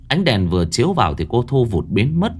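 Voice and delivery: usual speaking voice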